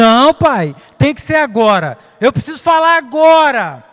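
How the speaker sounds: don't take no for an answer; insisting child. Retold or imitated.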